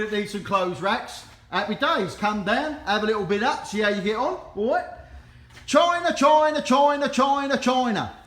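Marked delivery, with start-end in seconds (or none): 5.7-8.1 loud, high call of China, China that fades out at the end; sing-song calling out. Acted. Auctioneer hailing his viewers with a loud call. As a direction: hail the crowd like a market trader.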